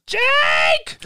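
high, loud yell of a name; mom yelling his name. Retold or imitated. Mom shouting for her son from the other room. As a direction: call him like an angry mom.

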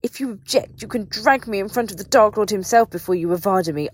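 high, loud defiance building in force; hysterical, loud dare; razor-edged fury. A narrator's reading. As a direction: hurl it at him, voice vibrating with rage. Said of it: "her voice razor-edged and vibrating".

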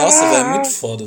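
high, fast burst of delight; excited. Real, not acted. Carried away by the refrain, gushing excitedly. Overwhelmed by the chorus.

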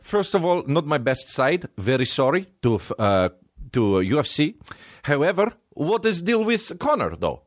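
a mock press-conference apology, Russian accent. Khabib apologizes to the press, hammed Russian accent. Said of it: "he was like".

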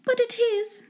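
high-pitched lady's retort; put-on high wife voice; insistent wife. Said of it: "returned she".

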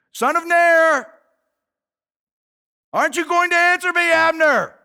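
high, loud long-distance holler; calling out. Acted. David calls again to sleeping Abner, loud holler. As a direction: keep hollering to rouse a sleeper. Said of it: "he called out to the army".

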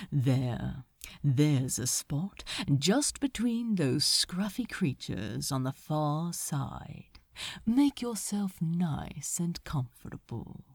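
low, soft, slow coaxing voice; soothing steward. A narrator's reading. Steward soothing Crowley into boat.

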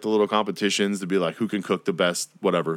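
usual speaking voice

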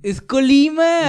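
"Colima" drawn out on LI, high and loud; insistent corrected stress. Shown as a demonstration. Co-host insisting on the state's name, long stressed LI in "Colima". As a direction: slowly hammer the middle syllable of "Colima". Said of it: "Sí tiene acento, no tiene tilde".

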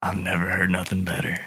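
softly spoken improvised dad. Pretend. Dad answering the boyfriend's threat, very softly. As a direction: drop to a gentle, quiet delivery. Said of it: "Why was that so soft?".

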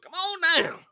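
high, loud showman's come on down; game show host. Retold or imitated. Waving a driver through like a game show host, booming. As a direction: belt it like a game show host calling a contestant. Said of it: "I'm like a game show host".

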